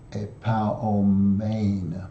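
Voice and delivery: "epaomein" stretched very slowly, syllable by syllable, with a long "ei" in the last; slow teaching pronunciation of "epaomein", ending on a held "mein"; long eta sound. Shown as a demonstration. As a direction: draw out "epaomein" to show the long final vowel. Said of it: "by the long eta sound".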